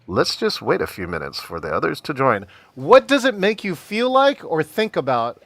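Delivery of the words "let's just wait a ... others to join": low, monotone host voice, slightly subdued; stalling meeting host. Acted. Meeting host quoting the dreaded phrase to attendees, low monotone. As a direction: drone it low and even, like a tedious host.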